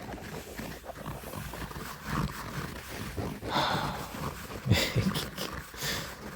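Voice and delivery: long, soft exhale; long moved sigh. Real, not acted. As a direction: breathe out slowly, overcome. Moved by the story.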